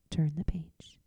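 whispered cue. Real, not acted. Reader whispers to the listener to turn the page.

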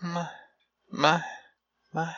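absent mutter under the breath; vacant, spaced-out mumbling. Acted. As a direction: keep muttering to yourself, oblivious. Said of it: "keeps muttering to herself".

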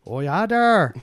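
badly faked Canadian accent; attempted Canadian accent. Shown as a demonstration. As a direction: exaggerate a folksy Canadian accent. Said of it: "Do a Canadian accent".

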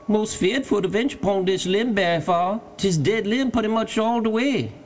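high-pitched dialect voice, wary; Jupiter, fearful servant. A narrator's reading. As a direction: call down your worry from the tree. Jupiter afraid on dead limb.

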